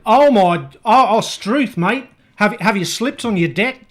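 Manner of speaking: loud, high, hammed-up bad Australian accent. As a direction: play a jolly Aussie bloke badly, loud and high. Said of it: "with the Australian accent".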